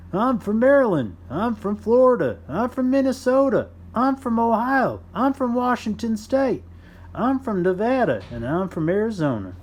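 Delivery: high, loud cheerful worker voices; proud workers. Acted. Workers from all over announce their states, high and loud. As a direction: call out your home state proudly.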